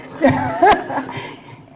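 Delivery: real bright laugh, breaking out high. Real, not acted. She laughs high and loud at her old retort.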